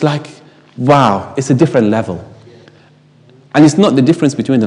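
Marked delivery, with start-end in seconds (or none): none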